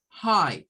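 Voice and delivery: high said like "jai"; high as in alto. Shown as a demonstration. Teacher showing students how high sounds. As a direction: give the word high its open ai sound. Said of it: "se reduce a high".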